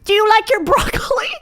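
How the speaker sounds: high, mocking singsong at "broccoli"; high teasing voice; teasing teachers. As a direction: tease the kid like the teachers did. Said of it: "the teachers start going".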